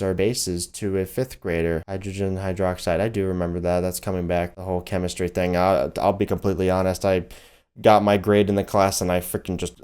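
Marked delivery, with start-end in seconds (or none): none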